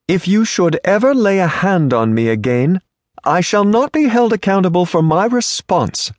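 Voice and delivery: high, haughty rebuke; prim indignant lady. Acted. Offended lady scolds the stranger who grabbed her, high and haughty.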